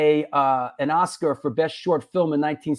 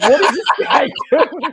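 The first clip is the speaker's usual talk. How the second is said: put-on laughing kid; 10 semitones higher than usual.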